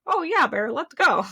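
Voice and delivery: high, eager mock cheer, going along with it; chirpy fake enthusiasm, pitched well up; mock excited crowd. Girlfriend mocks the crowd going along with the bear, in a high mock cheer. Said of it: "Everyone's like".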